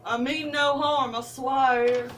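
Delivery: put-on playful Southern accent, rising in pitch. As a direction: lay on a mock Southern accent. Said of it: "I sure like to talk like it".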